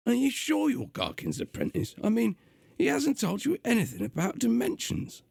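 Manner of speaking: gruff, high London demon voice; gruff London accent. Acted. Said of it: "doing a gruff, uh, London accent".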